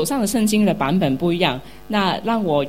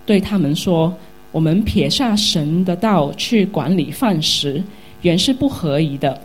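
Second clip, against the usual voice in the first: louder, measured Bible reading voice; scripture reading; 4 dB louder than usual. Acted. Pastor reading Acts chapter six aloud to the congregation, loud solemn reading. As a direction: proclaim the verses to the whole church.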